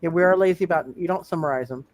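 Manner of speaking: ordinary talk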